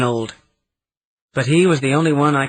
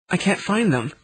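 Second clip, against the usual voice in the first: put-on high, small, pleading child's voice; a hint of a frightened little girl; piteous little girl voice; 6 semitones higher than usual. A narrator's reading. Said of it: "piteously in a voice like a little girl's".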